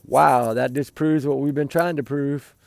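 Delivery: normal talking voice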